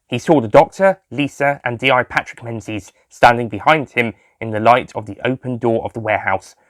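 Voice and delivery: ordinary delivery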